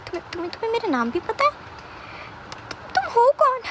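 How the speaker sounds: taken aback, tripping over the first words; high, startled demand; stammering shock. A narrator's reading. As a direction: stammer in startled disbelief, pitch leaping up. Startled that captor knows his name.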